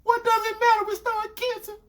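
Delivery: very high, defensive mimicry; protesting girlfriend. Girlfriend dodging who kissed who, imitated defensively.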